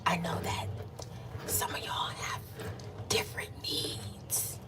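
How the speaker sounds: slow, soft whispered ASMR voice; mock ASMR whisper. Shown as a demonstration. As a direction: whisper teasingly like an ASMR video. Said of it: "if y'all want me to do A S M R".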